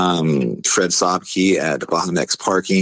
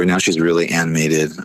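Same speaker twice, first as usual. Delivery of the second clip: ordinary talk, nothing marked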